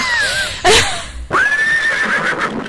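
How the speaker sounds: very high shrieking laugh; squealing laugh. Real, not acted.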